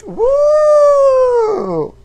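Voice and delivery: high piercing whoop; loud celebratory woo; pride whoop. Acted. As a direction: scream it like a party cheer. Pride cheer on talk show.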